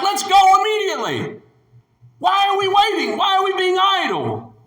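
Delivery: high, loud rallying shout; bold shout. Acted. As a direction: shout them into action. Caleb voiced urging boldly.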